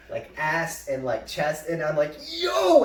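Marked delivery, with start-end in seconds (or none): 2.3-2.8 high, loud yelp of objection; retold alarmed shout; startled protest. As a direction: shout it as if suddenly grabbed. Startled protest at being grabbed.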